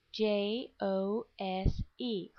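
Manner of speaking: letters spelled slowly one by one; slow spelling. Shown as a demonstration. Teacher spelling a name for the class, slow.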